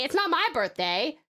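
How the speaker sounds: ordinary talk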